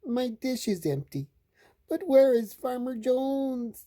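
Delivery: high-pitched hungry cat; high kitty voice. A narrator's reading. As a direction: speak as a little mewing cat. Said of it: "says the cat".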